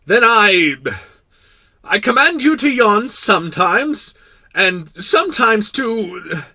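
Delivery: loud, high, halting royal fluster; sputtering, annoyed king. Acted. King whose order falls apart, sputtering. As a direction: sputter out a muddled order slowly. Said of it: "sputtering a little and seemed annoyed".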